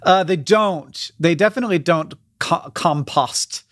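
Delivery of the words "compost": compost said as KOM-posst with a short o; bad British take on compost, short vowel in the last syllable; fake English pronunciation. Shown as a demonstration. Defending the slip as English, compost with a clipped second o. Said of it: "that's what they say in England".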